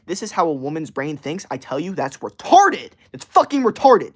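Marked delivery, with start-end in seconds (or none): 1.9-4.1 loud, high angry blast; real rant peaking into a shout; furious outburst. Real, not acted. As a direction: spit it out at full volume in disgust. Ranting at women's dating strategies.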